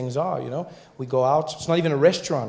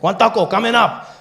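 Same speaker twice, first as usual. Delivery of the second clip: higher, louder vendor call; a high, loud call back from the truck; taco guy; 7 semitones higher, 5 dB louder than usual. Retold or imitated. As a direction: call the order like a street vendor. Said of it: "He's like".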